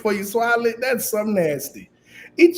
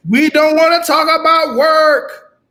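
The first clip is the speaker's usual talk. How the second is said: loud interruption; 6 semitones higher, 5 dB louder than usual. Real, not acted.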